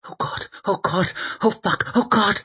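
high, whimpering terror; frightened, high-pitched panting chant; panicked swearing. A narrator's reading. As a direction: gabble it in mindless fear as you flee. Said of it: "His brainless swearing drumming away".

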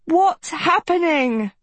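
high, slow, stretched-out cry; alarmed cry. A narrator's reading. Agatha amid frenzied animals, hands over ears, alarmed cry. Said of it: "Agatha cried".

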